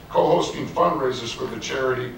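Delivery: ordinary talk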